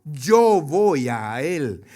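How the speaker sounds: very slow, drawn-out king's answer; King David's reply. Acted. King David telling servants he will go to his son, very slowly. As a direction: let each word sink, slowly.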